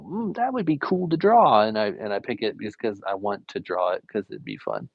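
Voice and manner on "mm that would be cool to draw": high-pitched, intrigued little musing; pleasantly taken by an idea, voice lifted. Retold or imitated. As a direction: act out spotting something fun, pitched up. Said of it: "and I go".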